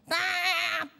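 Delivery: mock car horn "tat"; short imitated horn blast; horn beep.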